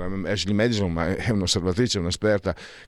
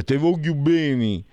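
slow Sicilian dialect phrasing; Sicilian accent; 5 semitones higher, slower than usual. Shown as a demonstration. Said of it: "in siciliano".

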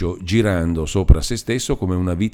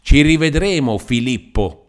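higher, louder challenge; defiant shout; 6 semitones higher, 4 dB louder than usual. A narrator's reading. Old man leaving, challenging Demetrio: defiant shout. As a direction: hurl the threat from the doorway. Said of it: "picchiando forte in terra gridò".

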